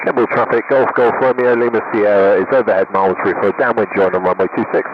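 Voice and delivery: steady formal radio transmission; radio call. Real, not acted. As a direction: rattle off the call sign like a pilot on the radio. Pilot radio call to airfield.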